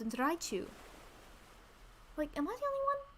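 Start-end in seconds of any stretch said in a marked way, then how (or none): 2.2-3.0 very high disbelieving squeak; incredulous squeak. Real, not acted.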